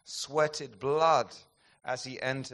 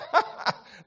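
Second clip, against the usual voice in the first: delighted laugh; 4 dB louder than usual. Real, not acted. Laughs at Finney story.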